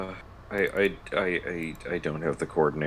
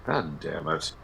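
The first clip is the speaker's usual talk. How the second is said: usual speaking voice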